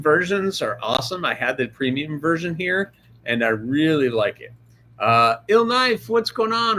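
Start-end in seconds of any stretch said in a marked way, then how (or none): 5.5-6.8 cheery greeting. Real, not acted. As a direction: stretch the name out in welcome. Greeting chat viewer by name.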